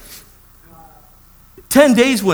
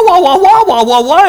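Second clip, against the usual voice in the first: loud wah-wah babble, starting high; mock an endless scolding over the phone; imitated cursing tirade; 9 semitones higher, 6 dB louder than usual. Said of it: "She was cursing me out".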